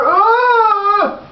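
sudden high cry of back pain; pained old man yelp. Pretend. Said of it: "My back".

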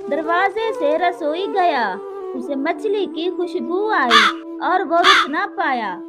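plain reading voice

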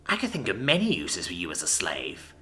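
low, soft sneer. A narrator's reading. The drow taunts the human about slavery, sneering. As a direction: sneer down at a lesser being. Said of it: "the drow sneered at the human".

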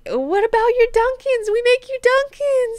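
very high, loud wheedling squeal; hammed-up high-pitched begging; mock desperate pleading. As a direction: squeal it like a panicked salesman. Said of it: "he's like".

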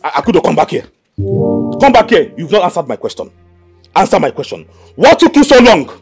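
loud, forceful interrogation; angry demand. Acted. Husband demanding why his wife took long, furious. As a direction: order her back, building to a shout.

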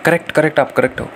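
normal talking voice

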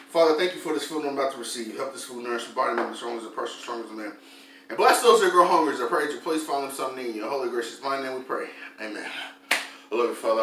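soft, fast prayer over food; quiet prayer. Acted. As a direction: murmur a quick grace. Leads a quick prayer before eating.

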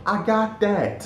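high, slow, drawn-out hype; exaggerated high-pitched gassing up; mock hyping up. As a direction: stretch each boast in a high voice. Acting out the gassing up.

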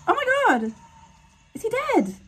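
high shocked Oh my God, then a falling Is he dead; shocked gasp. Real, not acted. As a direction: blurt it out in horror at the screen. Shocked by a death on screen.

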